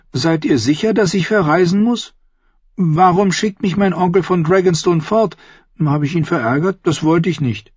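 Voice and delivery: put-on high, questioning child's voice; high-pitched boy seeking reassurance; young boy, Edric, troubled. Boy asking about being sent away, troubled.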